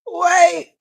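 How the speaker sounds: high, strained gasps while laughing; squeaky laugh-cry plea to stop. Real, not acted. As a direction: try to stop yourself laughing. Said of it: "I'm crying laughing now".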